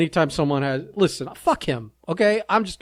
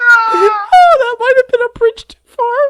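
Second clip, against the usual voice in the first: much higher, louder, crying with laughter; laughing; 9 dB louder, slower than usual. Real, not acted. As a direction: squeeze the words out through real laughter. Laughing fit.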